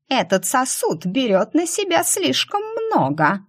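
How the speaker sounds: high, thin demon voice; gaunt demon Hunger. A narrator's reading. Gaunt demon objecting to the prisoner, high-voiced.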